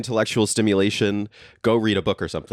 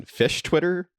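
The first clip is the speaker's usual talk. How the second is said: fish Twitter pitched way up in mock disbelief; incredulous; 11 semitones higher, slower than usual. Real, not acted. Host reacting to fish social media, incredulous high lilt. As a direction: toss it up high, half laughing.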